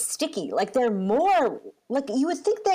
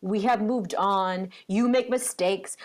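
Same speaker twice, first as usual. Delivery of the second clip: slow, deliberate, spaced-out words; measured parent reassuring a child; patient reassurance; 2 semitones lower, slower than usual. Retold or imitated. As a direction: spell it out patiently, phrase by phrase. Retold patient reassurance to son.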